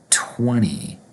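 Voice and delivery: "twenty" said as "twenny", falling. Shown as a demonstration. Teacher modelling American English twenty, N instead of T. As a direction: drop the second T in "twenty", just an N. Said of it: "we pronounce the number twenty in American Eng-, English with an N".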